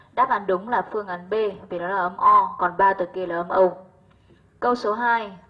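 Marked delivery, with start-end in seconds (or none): none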